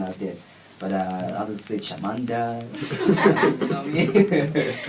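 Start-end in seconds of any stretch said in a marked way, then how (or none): 2.7-4.7 through a laugh, louder and higher. Real, not acted. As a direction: laugh while asking it. Laughing after joke.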